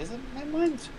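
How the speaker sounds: sudden high squeaky doubt; incredulous squeak. Real, not acted. Friend doubts the podcast's claim, squeaky disbelief. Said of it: "doubting, high squeaky question".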